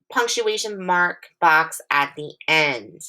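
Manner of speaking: ordinary talk, nothing marked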